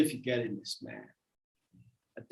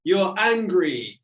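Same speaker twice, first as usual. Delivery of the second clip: ordinary delivery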